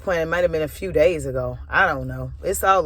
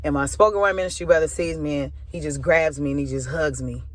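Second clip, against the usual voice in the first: ordinary talk, nothing marked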